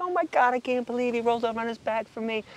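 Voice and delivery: high-pitched gush of disbelief; amazed delight. Retold or imitated. Man thrilled the pig rolled over for him, high amazed gush.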